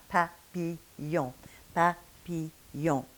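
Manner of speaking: slow papillon, ll said as a y glide. Shown as a demonstration. Teacher slowly modelling French papillon with y. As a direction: stretch papillon so the y sound shows. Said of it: "the next sound we have is y".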